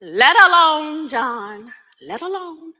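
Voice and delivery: high, loud and drawn-out command to leave her be; mother shouting at John to let the child be; protective shout. Acted. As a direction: stop him in his tracks. Mother stops father's whipping.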